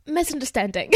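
says it in a British English accent; British English pronunciation on request. Shown as a demonstration. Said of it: "dis-le avec l'accent anglais, s'il te plaît".

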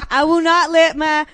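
put-on loud, high, drawn-out Southern belle accent. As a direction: declaim it in a hammed-up Southern drawl. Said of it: "where I get my southern accent".

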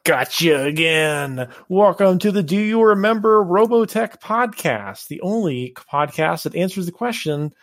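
podcast announcer. Acted.

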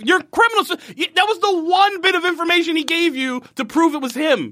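high-pitched disbelieving rant; exasperated rant. Real, not acted. Podcast host venting about a dumb scene, exasperated rant. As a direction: pitch it up in frustration at the stupidity. Ranting about plot holes.

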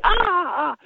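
high, soft gasps of pain. Retold or imitated. Dave in pain under Bob Bremer's grip, soft high gasps. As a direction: imitate a fit man gasping as his muscle tears. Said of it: "that Dave just going".

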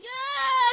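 high, loud playful scream; mock scream. Friends screaming on the roller coaster, mock scream. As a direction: scream like riders on the drop.

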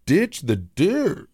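slow, flat-out blunt verdict; deadpan advice. Retold or imitated. Telling mom to dump the cable company, slow and blunt. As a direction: drag it out, dead serious. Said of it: "I'm like".

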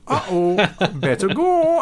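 high, slow, giggling uh-oh; sneaky getaway. Retold or imitated. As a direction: slip away like a sly golfer caught out. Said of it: "He goes".

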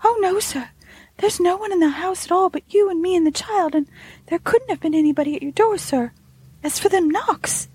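high, earnest maid's voice; anxious high-voiced denial to the master; earnest servant girl. A narrator's reading. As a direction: insist earnestly as the housemaid, pitched well up. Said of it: "she became earnest instead of evasive".

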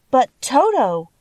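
put-on anxious girl, high and slow. A narrator's reading. Girl worries about her dog Toto, anxious. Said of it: "said the girl anxiously".

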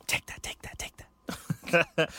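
soft whispered jingle; whispering. Acted. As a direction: breathe the chant in a whisper.

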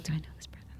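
whispered aside, breath only; whispering half to herself, wondering who he is; puzzled whisper. Retold or imitated. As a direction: whisper it to yourself, confused. Puzzled aside at grocery store.

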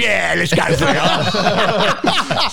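drawn-out, high goofy cheer; slow-motion hammed-up enthusiasm; mock eager agreement. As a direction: play yourself gleefully joining in, laughing. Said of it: "And I'm like".

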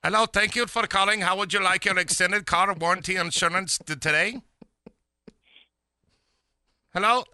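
high, loud scam caller voice; hammed-up telemarketer pitch, loud and high; robocall. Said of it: "I'm gonna do a joke here".

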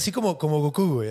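usual speaking voice

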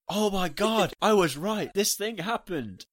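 high, loud gushing voice; excited friend. Retold or imitated. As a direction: squeal it out like a thrilled friend. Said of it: "She was like".

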